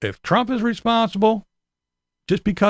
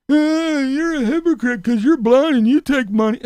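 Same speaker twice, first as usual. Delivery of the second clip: mocking a critic; 7 semitones higher than usual.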